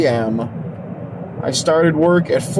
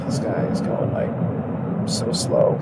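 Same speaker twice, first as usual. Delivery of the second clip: whispering; 4 semitones lower, 6 dB quieter than usual. Real, not acted. Whispered complaint about slow driver.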